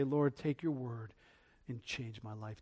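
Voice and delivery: slow, low, very soft plea; hushed prayer. Retold or imitated. Believer coming to God's Word, slow hushed prayer. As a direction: let it sink to a near murmur.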